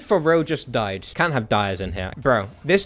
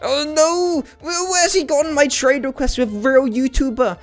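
high excited outcry; high panicked disbelief; 9 semitones higher than usual. Real, not acted. Streamer reacts to trade request, high panicked outcry.

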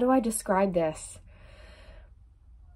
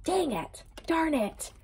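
high, much softer, mock disappointed; soft playful groan; 7 semitones higher, 6 dB quieter than usual. Real, not acted. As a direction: whine it under your breath, teasing. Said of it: "And then the Sun card came out".

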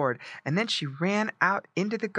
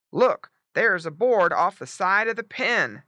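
louder, higher call to look; lightly voiced urgent father pig; father pig alert; 5 semitones higher, 5 dB louder than usual. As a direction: point it out as Mister Pig. Father pig points out board.

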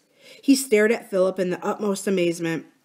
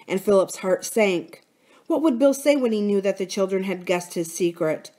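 usual speaking voice

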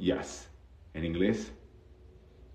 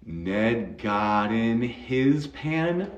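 very slow demo; 2 semitones higher, much slower than usual. Shown as a demonstration. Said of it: "but I cut something".

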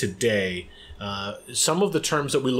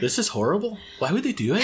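higher mock Irish accent; put-on Irish accent; 7 semitones higher, faster than usual. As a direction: quote dismayed listeners in a pretend Irish accent. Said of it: "I'm gonna do this Irish accent".

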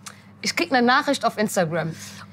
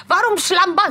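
indignant mother; 7 semitones higher, 4 dB louder than usual. Retold or imitated. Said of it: "Sie sagt mal Schlamba".

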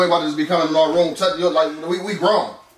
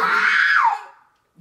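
long high call across the room. Real, not acted. Calling kid to get up.

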